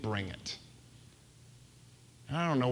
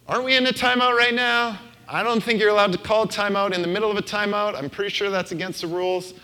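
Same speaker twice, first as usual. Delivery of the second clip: high, loud, fast mock protest from a listener; imagined listener objecting; 9 semitones higher, 8 dB louder, faster than usual. Pastor voicing a congregant who objects to another timeout, high, loud and fast. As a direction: play the nitpicky congregant calling foul. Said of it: "I know you're thinking".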